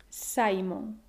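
Simon as SAI-mon, low and falling; stress on penultimate syllable. Shown as a demonstration. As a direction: lean on SAI in Simon, Spanish-style. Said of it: "con el acento en la penúltima".

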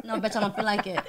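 low, soft mock brush-off; grumpy refusal. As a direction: imitate a partner turning you down. Voices partner refusing her advances.

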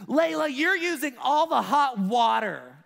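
put-on loud, high, guttural kid's yell; frustrated yell. Five-year-old yelling at his sister over the hot water, frustrated shout. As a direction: blurt it out, fed up and loud. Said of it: "guttural, frustrated, coming out of nowhere".

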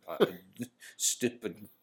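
ordinary talk

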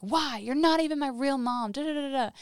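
high, loud protest; mock teenage backtalk; imitated angry teen. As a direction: throw a kid's indignant tantrum line at mom. Child protesting a refusal, retold.

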